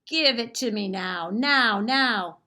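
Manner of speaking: demanding troll. Acted. Greedy troll yelling at the bears for his money, loud. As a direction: stamp out each now like a tantrum.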